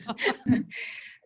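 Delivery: short airy chuckle at her own joke; amused breathy laugh after a boast. Real, not acted.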